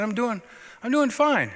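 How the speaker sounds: high, strained reassurance from a sick man; voicing Ralph. Dying friend insisting he's fine, high and strained.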